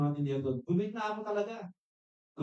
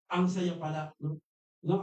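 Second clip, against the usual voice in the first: ordinary delivery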